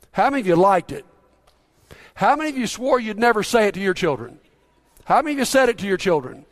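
ordinary delivery

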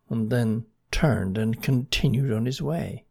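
plain reading voice

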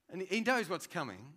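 ordinary talk

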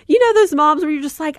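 ordinary talk

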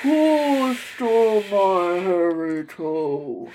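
very slow, drawn-out spooky moan; haunting faint call; ghostly moaning voice. Acted. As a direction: moan it like the wind far off. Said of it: "faint rumblings that she swore was saying".

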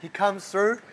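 ordinary talk

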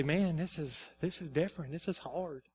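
low, soft, weary admission; quiet confiding. Retold or imitated. Pastor confiding in Tanya about his job, subdued and low. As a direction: confide it softly, dropping away.

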